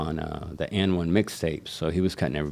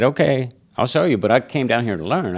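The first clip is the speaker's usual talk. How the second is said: higher, louder bold answer; fired-up reply; 6 semitones higher, 4 dB louder than usual. Retold or imitated. Young barber accepting the crowd's dare, higher and louder. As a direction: rise to the challenge.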